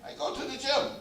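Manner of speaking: high mock French accent. Preacher repeats the French lady's line for laughs, French accent. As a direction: savour the imitated French accent. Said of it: "with the French accent".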